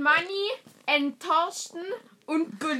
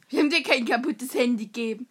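ordinary talk, nothing marked

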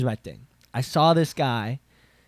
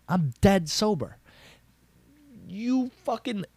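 higher-pitched exasperated insistence; indignant protest; 9 semitones higher than usual. Retold or imitated. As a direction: protest in disbelief, voice climbing high. Indignant retelling of being kicked out.

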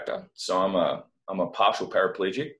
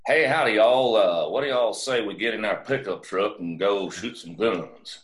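put-on Texas accent; 2 semitones higher, 4 dB louder than usual. [name] mocking a Texan to fit in, hammed-up Texas accent. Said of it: "change his accent to be a Texas accent".

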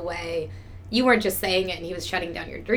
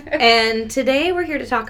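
hoarse voice; 5 semitones higher, 7 dB louder than usual. Real, not acted. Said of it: "Sexy voice number two".

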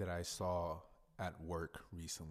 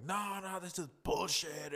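high, loud indignant protest, imitating the husband; angry refusal; 11 semitones higher, 4 dB louder than usual. Husband losing his temper at his wife in the lobby. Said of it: "cussing up a storm".

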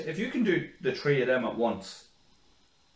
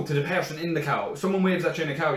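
normal talking voice

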